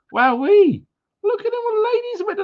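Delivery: squeaky high put-on voice, fast; falsetto wowee. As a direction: swoop way up into a silly falsetto. Greets many women viewers in falsetto.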